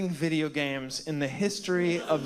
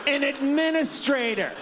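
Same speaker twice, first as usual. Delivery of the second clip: mock outrage; 6 semitones higher than usual. Mock outrage, escalating list.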